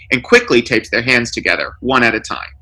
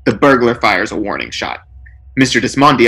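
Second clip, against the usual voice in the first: ordinary delivery, nothing marked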